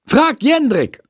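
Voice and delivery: high, brisk answer; energetic teacher. A narrator's reading. Busy teacher brushing off a question, high brisk reply. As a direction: snap it back briskly. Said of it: "antwortet Frau Schmidt energisch".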